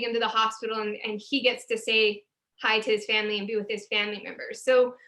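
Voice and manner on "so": usual speaking voice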